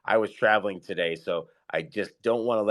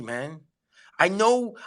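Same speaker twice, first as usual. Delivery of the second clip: ordinary talk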